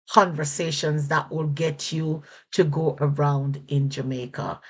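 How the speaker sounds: ordinary delivery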